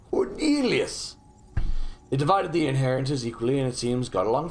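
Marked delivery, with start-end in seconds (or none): 0.1-1.2 high swooping cry of the name, falling away; mock cry. Player savouring Cornelius Douglas's name, mock cry. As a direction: draw the name out high, then let it drop.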